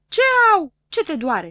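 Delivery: high, loud astonished question; puzzled, as if nothing should hurt; surprised. Acted. Doctor Pilulă surprised at his patient's yell, high and loud. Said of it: "se miră Pilulă".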